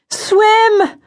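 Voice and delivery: high, urgent shouted command; urgent yell. A narrator's reading. Aluna to Hoku as the shark charges, yelling. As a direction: yell it high to make someone move. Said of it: "Aluna yelled".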